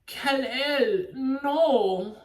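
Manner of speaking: mocking bad-actress impression, drawn out; overdone movie-line parody; Gal Gadot. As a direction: stretch it into a loud, high, stilted cry. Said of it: "one I most think of is".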